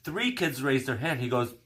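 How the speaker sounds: normal talking voice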